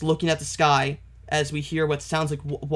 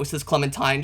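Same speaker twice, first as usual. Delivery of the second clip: ordinary delivery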